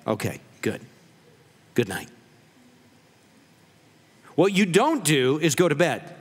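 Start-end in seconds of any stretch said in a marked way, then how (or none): 0.1-2.1 much lower and softer, winding down; soft good night. Acted. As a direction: settle down for sleep. Couple settling down for bed.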